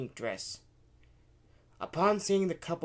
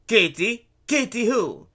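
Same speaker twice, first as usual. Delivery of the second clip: loud, raised, challenging questions; demanding; 8 semitones higher, 11 dB louder than usual. A narrator's reading. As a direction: demand an answer from the children. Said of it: "Luigi demanded".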